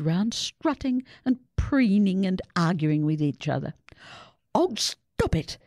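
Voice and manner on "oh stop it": ordering. A narrator's reading. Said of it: "Susie ordered".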